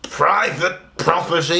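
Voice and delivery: a mild, hammed-up telling-off; mock angry yell. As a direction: put on an indignant old man, drawing out the words. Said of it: "an angry old man just burst through the doors ... and started yelling".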